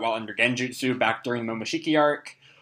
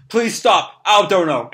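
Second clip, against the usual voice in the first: pleading cry; 5 semitones higher, 4 dB louder, slower than usual. Acted. Katasuke jumps out pleading.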